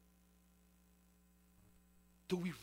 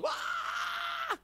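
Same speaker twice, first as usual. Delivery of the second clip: a sudden high mock yell from dad; angry dad roar. Dad catching kids writing on walls, mock roar. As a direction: bellow like a furious father catching you. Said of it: "dad comes around and goes".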